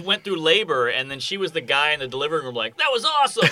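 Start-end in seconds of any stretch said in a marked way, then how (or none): 2.8-3.5 high-pitched gushing impression; mock delighted. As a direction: gush like a thrilled onlooker. Imitates delighted onlooker after labor.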